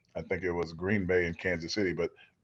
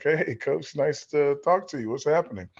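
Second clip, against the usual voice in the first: higher-pitched, casual imitated hello; chummy greeting; 6 semitones higher than usual. Young player answering the coach's call, casually chummy. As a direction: greet him lightly, voice lifted.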